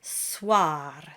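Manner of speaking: Soir with dark ah and a flipped R. Shown as a demonstration. Teacher models the word with a tapped R. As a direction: say Soir and flip the R. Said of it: "flip the R at the end".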